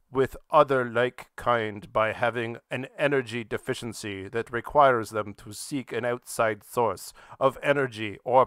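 slow, deliberate reading of the definition; reading quoted definition. Shown as a demonstration. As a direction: read it out slowly as a formal quote. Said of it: "Their definition starts with".

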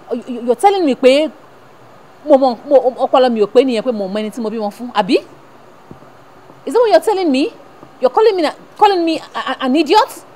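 hurt and outraged, demand an answer; indignant scolding. Acted. Mother scolds son for calling her an idiot, loud indignant.

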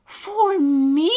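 high, loud rising question; amazed little character. Acted. Alistair cannot believe the job is his, amazed. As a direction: ask in disbelieving delight.

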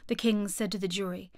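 plain reading voice

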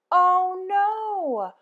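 ordinary delivery

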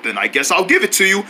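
normal talking voice

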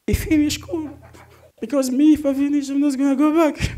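high-pitched disbelief; high exasperated. Real, not acted. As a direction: marvel at it in disbelief.

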